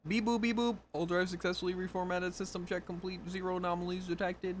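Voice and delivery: robot booting up. Acted. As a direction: rattle off diagnostics like a machine. Robot rebooting, reading dialogue.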